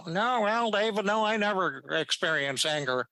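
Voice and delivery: high, bright-edged New York twang; a light imitation of an old New Yorker's voice; an imitation of Albert Ellis's New York accent. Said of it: "this is the way he talked".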